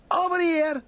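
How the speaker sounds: high loud call across the room; high nonna shout; grandmother calling out. A narrator's reading. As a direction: shout to her son over the crowd. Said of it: "shouted Mighty Mama".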